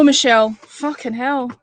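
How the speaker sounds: ordinary talk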